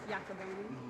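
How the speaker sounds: soft questioning "Iacoboni", rising at the end; quiet repeat. Real, not acted. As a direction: echo "Iacoboni" under the breath as /jækəboʊni/, checking it. The last name is Iacoboni.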